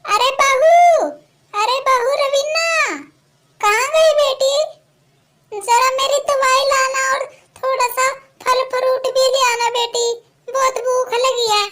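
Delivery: put-on a hungry old woman calling through the house. Old woman calling daughter-in-law.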